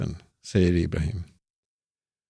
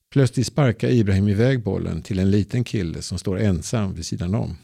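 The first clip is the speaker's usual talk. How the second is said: ordinary delivery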